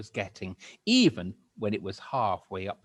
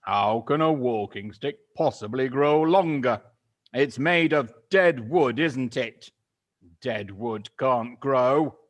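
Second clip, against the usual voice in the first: scornful Mister Twit; 3 semitones higher, 10 dB louder than usual. Acted. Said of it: "Mister Twit said".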